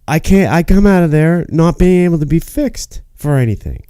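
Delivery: usual speaking voice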